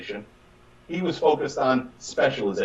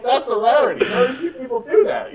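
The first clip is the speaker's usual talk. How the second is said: higher quote said through a laugh; 8 semitones higher, 3 dB louder than usual. Retold or imitated. Retelling a colleague's boast, laughing. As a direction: laugh while you quote him.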